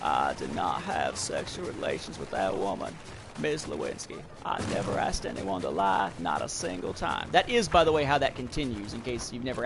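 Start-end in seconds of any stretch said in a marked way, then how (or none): 0.0-7.3 low, lightly voiced Bill Clinton with faux sincerity; mock Clinton's squinting sincere denial; Clinton impression. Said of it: "Be like".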